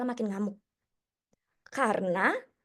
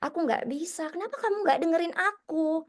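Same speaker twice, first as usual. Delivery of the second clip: put-on frustrated child; 6 semitones higher than usual. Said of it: "Jadi murid tuh juga sama".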